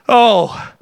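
ordinary delivery

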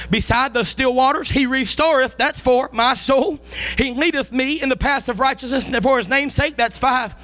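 usual speaking voice

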